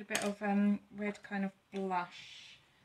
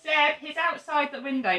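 higher, loud call for Seb; 6 semitones higher, 12 dB louder than usual. Real, not acted. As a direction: shout the name to get attention. Calling Seb outside.

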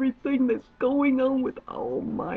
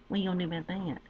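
ordinary talk

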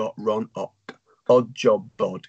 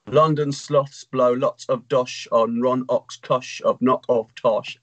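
ordinary delivery

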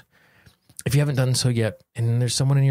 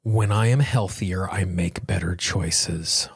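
guided tapping affirmations. Acted. Guiding listeners through a tapping session, slow and calm. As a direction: lead the listener gently through each phrase.

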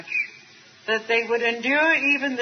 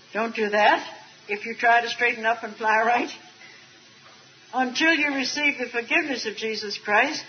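usual speaking voice

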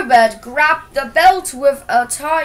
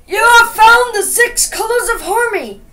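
high, loud proclamation; loud, high-pitched congratulatory announcement; congratulating Color Master; 9 semitones higher, 5 dB louder than usual. A narrator's reading. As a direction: proclaim congratulations as a grand story character. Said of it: "congratulated the legendary color master".

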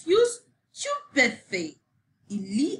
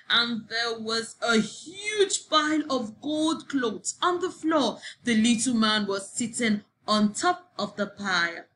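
ordinary delivery, nothing marked

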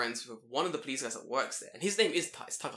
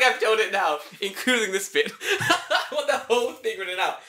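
laughing; 6 semitones higher, 8 dB louder than usual. Real, not acted. Laughing at his own story.

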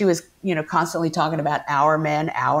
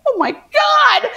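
high, loud, drawn-out squeal; thrilled shriek; 6 dB louder, slower than usual. Retold or imitated. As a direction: shriek it like you just got an audition. Retold reaction to big audition.

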